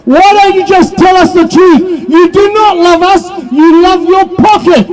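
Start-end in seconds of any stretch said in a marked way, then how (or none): none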